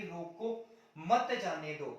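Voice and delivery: "रोको", short break, then a firm "मत जाने दो"; pause after रोको. Shown as a demonstration. Teacher showing the comma's effect to students, pause after रोको. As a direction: hold off after "रोको", stress "मत" and fall to the end. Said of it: "coma लगाते ये विराम".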